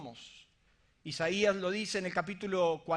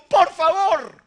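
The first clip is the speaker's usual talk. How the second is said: imitating crying plea; 10 semitones higher, 15 dB louder, much slower than usual. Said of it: "Y llorando me dijo".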